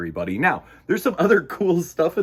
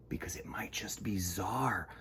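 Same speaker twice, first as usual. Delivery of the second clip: hushed secret whisper; soft whisper; 6 semitones lower, 13 dB quieter than usual. Real, not acted. Host whispering to children about Czar visiting.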